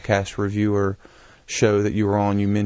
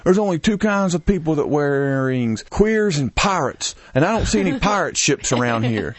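caller's quote; 6 semitones higher than usual. Retold or imitated. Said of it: "he called in and he says".

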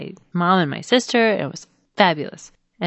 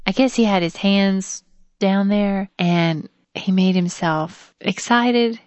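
normal talking voice

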